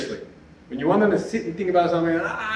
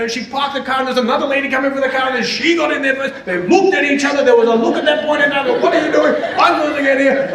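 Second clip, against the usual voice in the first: higher, building excitement as the story runs on; excited retelling; 6 semitones higher than usual. Retold or imitated. Teacher retelling mother's parking drama, higher and excited. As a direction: pump up each step of mom's supermarket saga. Mother's supermarket saga retold.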